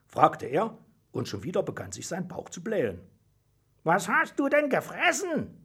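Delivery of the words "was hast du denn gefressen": high, swooping astonished question; appalled question. A narrator's reading. Joschka asks the smelly contestant what he ate, appalled. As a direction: ask in high-pitched disbelief.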